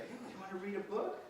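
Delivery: imitating the teacher quizzing a child, high and fast; teacher's question. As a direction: pepper her with a cheery yes-or-no question. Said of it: "she would look at me and be like".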